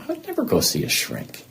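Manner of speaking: mock-appalled refusal; indignant refusal. Retold refusal to see a shrink.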